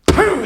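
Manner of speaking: loud, high burst of impact; punch noise. Retold or imitated. Boy sucker punches him, explosive punch noise. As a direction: spit out a sudden punch sound.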